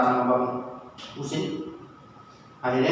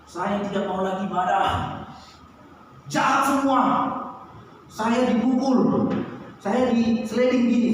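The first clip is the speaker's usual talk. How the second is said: put-on higher, child's tearful complaint, slow on the hurt; crying child; 4 semitones higher than usual. Son tells father he was hurt, higher tearful child voice. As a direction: play the sobbing boy who got knocked down. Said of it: "Dia langsung nangis, teriak".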